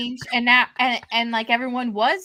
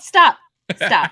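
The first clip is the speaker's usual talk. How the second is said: yelling; 3 semitones lower, 9 dB louder than usual. Retold or imitated. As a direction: yell it sharply. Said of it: "And then she yelled".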